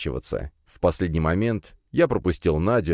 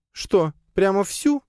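high, drawn-out astonished question; amazed; slower than usual. A narrator's reading. Spider familiar to master, asked for a whole map, amazed. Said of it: "изумилась паучиха".

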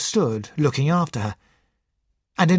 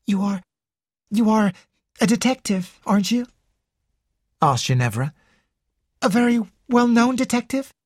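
put-on higher, eager young woman; 8 semitones higher than usual. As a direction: play an excitable young heiress. Ginevra voiced by narrator.

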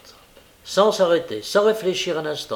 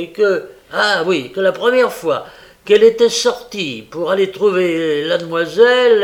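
loud, higher, halting informer; Noé the informer; 5 semitones higher, 9 dB louder than usual. Acted. Noé telling of the laudanum, loud informer. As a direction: blurt out the report, with a laugh midway.

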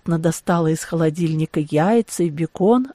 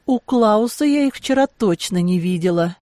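ordinary delivery, nothing marked

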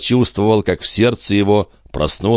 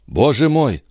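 higher-pitched cry of dismay; alarmed exclamation; 8 semitones higher than usual. Acted. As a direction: exclaim in sudden worry. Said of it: "вскричал Жильбер". (встревоженное восклицание повышенным тоном)

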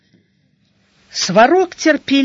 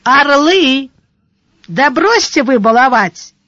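louder, higher call to a crowd; good-natured shout; 4 semitones higher, 4 dB louder than usual. A narrator's reading. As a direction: call up to them without malice. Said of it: "крикнул Сварог беззлобно". (беззлобный окрик)

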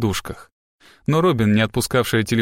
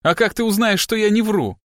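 high-pitched challenge; defiant; 9 semitones higher than usual. A narrator's reading. Captive girl challenges the detective, defiant. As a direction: dare him, pitched right up. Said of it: "с вызовом бросила она".

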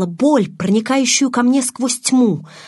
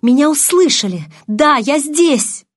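excited relief; 5 semitones higher, 4 dB louder than usual. A narrator's reading. Heroine realizes she is heard.

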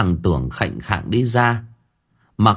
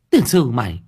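much higher, louder exasperated scolding; shrill scolding yell; 12 semitones higher, 4 dB louder than usual. A narrator's reading. Woman scolding the boy about the nettle leaf, loud high yell. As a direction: scold him loudly in disbelief. Thơm yells at Tưởng.

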